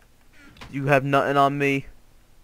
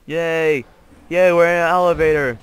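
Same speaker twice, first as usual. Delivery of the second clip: raised, loud celebration; gleeful cheer; 4 semitones higher, 8 dB louder than usual. Real, not acted. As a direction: cheer it out with drawn vowels. Celebrates the elevator going up.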